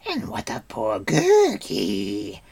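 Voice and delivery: loud, drawn-out creature wail; howling Gurgi. A narrator's reading. Gurgi left out of the thanks, howling. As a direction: howl it pitifully. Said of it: "howled the creature".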